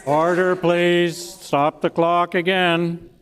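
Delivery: ordinary delivery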